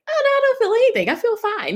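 höher, schnell, ungläubig: Oh, no; surprised denial. Retold or imitated. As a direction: wischt die Sorge lachend weg. Denying contractions to the nurse.

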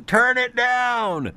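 high, drawn-out holler; yelling down the stairs. Retold or imitated. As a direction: imitate a parent yelling to another floor. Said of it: "you'd be yelling down the stairs".

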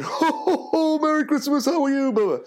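high, jolly ho-ho greeting; Father Christmas voice. Acted. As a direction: boom a merry hello in a put-on Santa voice. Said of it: "And I was going".

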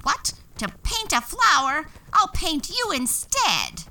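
loud, high furious witch cry; angry witch. A narrator's reading. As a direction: shout the threat like a wicked witch. Witch threatens the fairy.

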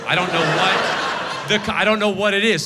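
ordinary delivery

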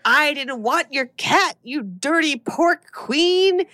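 high, slow mock-demon taunt; hammed-up demon scream without much volume. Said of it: "screams in a demon voice".

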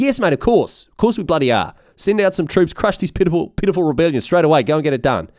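high, exasperated mock commands; impatient emperor. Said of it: "He goes".

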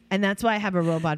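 ordinary talk

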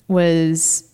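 usual speaking voice

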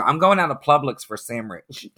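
Publix as "Plublix", sandwich as "samrich"; aunt's mispronunciation. Retold or imitated. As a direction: imitate the aunt garbling Publix and sandwich. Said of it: "she couldn't say Publix and she couldn't say sandwich".

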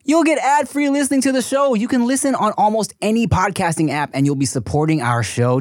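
host reading the sponsor spot in a loud ad voice. Acted. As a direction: pitch the ad-free version like an announcer. Reads the podcast ad.

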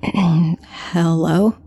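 small, hesitant hello after clearing the throat; nervous, questioning hello; choked-out greeting. A narrator's reading. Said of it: "choked out a single".

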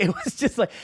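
ordinary delivery

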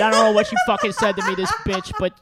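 hammed-up laughing impression of a horny girlfriend; laughing mock inner voice. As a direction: crack up while voicing her crude thoughts. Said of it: "I'm laughing at the throwaway where she's like".

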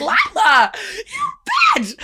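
high, loud drawn-out mock yell; mock scolding. Retelling how she called out her friend, mock scolding shout. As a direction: shriek it like a scandalized friend.

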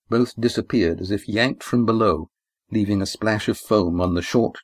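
plain reading voice

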